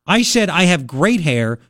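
slow, deliberate "great hair"; "great" leaned on to correct a mishearing; stress on GREAT. Retold or imitated. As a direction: spell out "great hair" word by word. Clarifying great versus gray.

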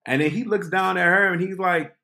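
ordinary talk, nothing marked

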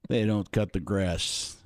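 low, clipped-off football coach talk; Jon Gruden. Acted. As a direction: gridiron coach impression. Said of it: "Gruden used to say".

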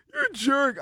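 high, whiny fake sobbing; mock crying. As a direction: whine it like a fake crybaby. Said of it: "start busting out crying like".

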